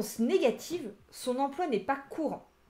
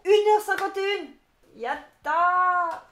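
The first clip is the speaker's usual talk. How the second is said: much higher and louder, slow victorious shout; triumphant cheer; 7 semitones higher, 9 dB louder, much slower than usual. Real, not acted. Teacher cheering to viewers that the class is over. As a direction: celebrate finishing the lesson. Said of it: "triumphant end of class".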